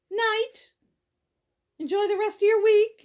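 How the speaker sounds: ordinary delivery, nothing marked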